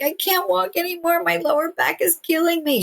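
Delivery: high, plaintive retold wail; imitated crying complaint. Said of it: "she was crying".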